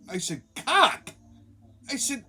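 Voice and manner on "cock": high, incredulous "Cock?"; baffled echo. Retold or imitated. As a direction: echo "Cock?" back in disbelief. Baffled echo of her word.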